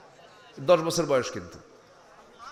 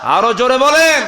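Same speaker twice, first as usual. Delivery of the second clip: very loud, high drawn call; full rallying shout; 9 semitones higher, 12 dB louder than usual. Real, not acted. Preacher pushing the crowd to the limit, full shout.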